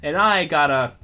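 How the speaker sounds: a hint of a Southern colonel accent; Southern gentleman accent. GM voicing Jedediah to the party in a Southern accent. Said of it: "Literally Foghorn Leghorn".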